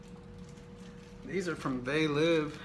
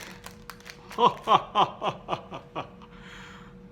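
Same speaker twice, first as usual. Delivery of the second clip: laughing; 6 semitones higher than usual. Real, not acted. Laughing at own pun.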